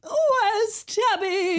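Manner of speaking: high, very drawn-out imitation call; mock vibrato call. Joining the co-host's Tabby bit, mock wailing. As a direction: wail it out as a hammed-up search cry. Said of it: "The vibrato".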